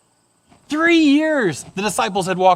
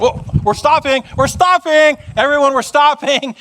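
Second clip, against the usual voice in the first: high, flustered announcements; comic pile-up, calling back down the line; hammed-up crowd halting in alarm; old comedy movie crowd; 6 semitones higher, 3 dB louder than usual. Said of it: "just like an old comedy movie".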